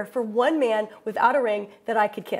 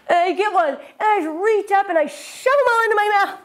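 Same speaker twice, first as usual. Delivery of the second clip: louder, higher, tumbling rush; frantic excitement; 6 semitones higher, 5 dB louder than usual. Real, not acted. Telling the audience about the spa madness, loud and giddy. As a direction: burst out with wild glee.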